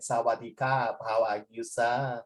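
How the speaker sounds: put-on low, soft Thai-accented greeting. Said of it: "because they talk like that".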